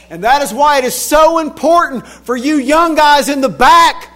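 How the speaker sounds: put-on loud, high shouted warning to the young men; fiery pulpit shout; preaching at full force. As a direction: preach it hard to the back rows.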